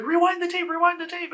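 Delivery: high-pitched panicky urging; freaking out. Acted. Trapped girls ordering each other to check the footage, freaking out. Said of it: "the girls are freaking out".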